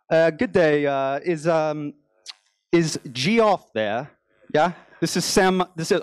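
a put-on British accent drifting toward Indian and Australian, slow and drawn out; fake British accent. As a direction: play an American faking a British accent on a sales call. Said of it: "with a fake British accent".

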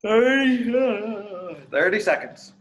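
high, loud time call. Acted. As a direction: shout the time across the gym. Coach calls time mid-drill.

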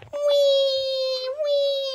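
high, drawn-out pretend wail; mock baby crying in a squealed voice; crying baby toy. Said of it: "She go".

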